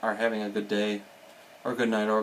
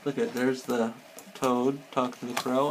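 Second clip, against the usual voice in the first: usual speaking voice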